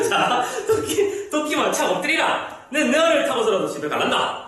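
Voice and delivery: laughing through a Gyeongsang line, pitch up; Gyeongsang dialect. Acted. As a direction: read it in Gyeongsang dialect, giggling. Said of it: "경상도 방언이라고 생각하고".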